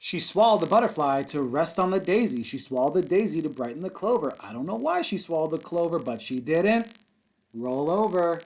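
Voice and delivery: lively singsong read-aloud for kindergarten; picture book storytime voice; reading to children. Acted. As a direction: read it with a bouncy rhythm for little kids. Teacher reads picture book aloud.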